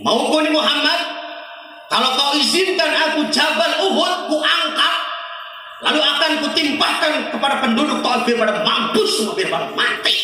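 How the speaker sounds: high, loud threatening offer; high loud vengeful rant; vengeful Jibril. Acted. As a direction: threaten to drop the mountain on them. Jibril offers to crush Taif.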